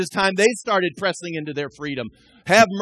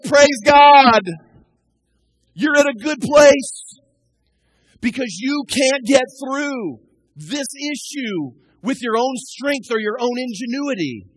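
joyful praise; 4 semitones higher, 6 dB louder, slower than usual. Retold or imitated. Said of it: "I want to say".

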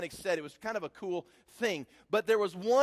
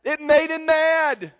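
higher, louder, slow punch; emphatic repeat; 9 semitones higher, 12 dB louder, slower than usual. Shown as a demonstration. Preacher repeating the punchline to the congregation, much louder and higher. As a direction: drive the line home to the crowd. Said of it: "repeated with force".

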